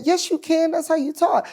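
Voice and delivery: high-pitched mock insistence; retold insistent comeback. Writer insists back to the comedian, retold in a high voice.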